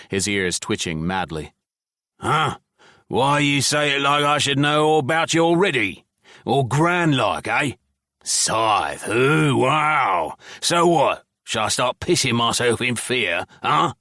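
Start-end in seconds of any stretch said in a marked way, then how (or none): 2.2-13.9 higher, louder, sneering scornful goblin; goblin mockery. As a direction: goblin mocking Scythe's grand name, loud and sneering. Goblin mocking the human.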